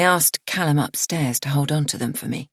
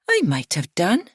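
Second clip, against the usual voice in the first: light but defensive; 4 semitones higher than usual. A narrator's reading. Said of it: "Her tone is light but defensive".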